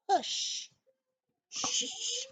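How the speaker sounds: soft, airy shushing; drawn-out "shh" in a hushed voice; hushing. A narrator's reading. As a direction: shush someone quietly. Characters hushing the koala.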